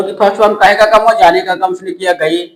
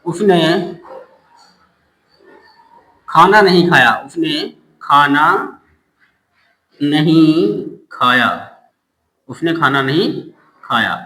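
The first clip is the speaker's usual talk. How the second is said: slow dictation; 3 semitones lower, slower than usual. Shown as a demonstration. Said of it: "dictating a question".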